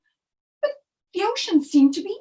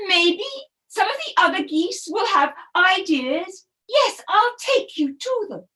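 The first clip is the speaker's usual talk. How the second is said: louder, flustered but kind goose; kindly mother goose; 4 semitones higher, 4 dB louder than usual. Acted. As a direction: stammer kindly, apologetic. Kindly goose answers Turtle.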